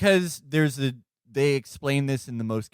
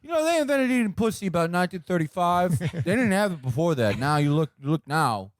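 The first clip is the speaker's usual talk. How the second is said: higher-pitched, halting wiseguy ramble; rambling mobster impression; 6 semitones higher than usual. Imitating wiretapped guys' asinine talk, rambling mobster. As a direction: play a mobster holding forth idly. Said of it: "these guys just saying".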